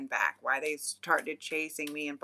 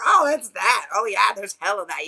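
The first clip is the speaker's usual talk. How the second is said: loud, high retold exclamation; acted sudden recognition; 5 semitones higher, 12 dB louder than usual. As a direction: burst out as if it suddenly clicks. Voicing locals recognising the plant's name.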